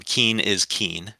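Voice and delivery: "Keene" said slowly, a long ee in both. Shown as a demonstration. Host ruling on a disputed pronunciation, "Keene" with a long, unhurried ee. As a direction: repeat "Keene is Keene" evenly, rhyming with "seen". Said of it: "regardless of how they may be pronounced".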